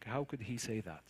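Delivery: ordinary talk, nothing marked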